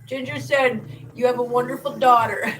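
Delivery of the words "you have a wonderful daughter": laughing, flattered. Real, not acted. Reading a viewer's compliment aloud, laughing. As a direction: giggle while quoting.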